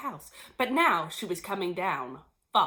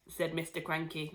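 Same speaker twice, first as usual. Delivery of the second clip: plain reading voice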